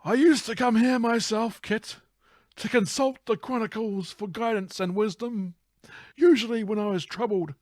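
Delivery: high-pitched character voice; earnest father figure. Acted. Acting the father's confession to Kit.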